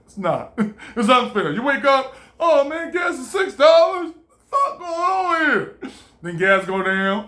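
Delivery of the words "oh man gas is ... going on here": mock outrage. Reacting to gas price shock.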